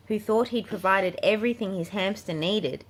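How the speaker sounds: ordinary delivery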